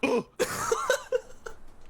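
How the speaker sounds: very high shocked gasp; big gasp. Acted. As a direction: gasp as if stunned. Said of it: "One more time?".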